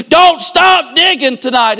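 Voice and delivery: high, loud and slow, every word hammered; climactic preacher's cry. Acted. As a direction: shout it as the final charge. Climax of the sermon.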